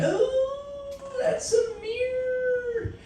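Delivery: high, soft sobbing voice, slowing down; mock crying. Realising it's only a mirror, a hammed-up high sob. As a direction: whimper it like a pitiful mistake.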